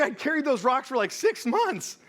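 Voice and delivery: mock-outraged complaint; indignant. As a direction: stretch out six months in disbelief. Punchline of retold story.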